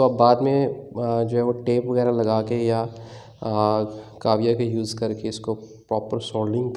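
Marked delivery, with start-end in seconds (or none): none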